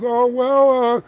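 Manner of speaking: high, loud hedging salesman; flustered seller. Acted. Camera seller gives in to haggling customer, flustered, high-pitched. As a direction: hem and haw, then offer a deal. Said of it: "And he goes".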